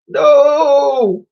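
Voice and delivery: high, loud wail of alarm; falling cry. Acted. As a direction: cry out as you drop. Sailor falling into sea.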